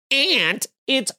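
high, snooty correction; hammed-up pedant correcting aunt to ahnt; mocking a pedant: "aunt" turned to ahnt. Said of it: "and you're like".

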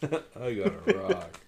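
sad flat monotone, slow; monotone Charlie Brown. Retold or imitated. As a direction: say it slowly in a dull flat voice. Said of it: "It's a poor monotone voice every time".

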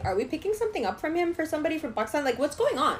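retold teenage demand for answers; high, exasperated questioning; bewildered questioning. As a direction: pitch climbing in disbelief. Retold teen demands explanation.

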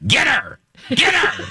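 high, goading devil's chant; squeaky devil on the shoulder; little devil egging on. Pretend. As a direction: egg yourself on like a tiny devil. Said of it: "the devil is me going".

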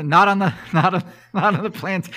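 high, loud laughing answer. Real, not acted. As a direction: laugh through the words. Laughing at the question.

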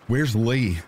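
high, forceful demand. A narrator's reading. Mother demands to know where her son is. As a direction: insist on an answer now. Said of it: "I demanded".

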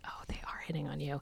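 hushed, whispered realisation; very soft, breath-only reveal; whispered aside. Retold or imitated. Aside revealing the obvious to friend.

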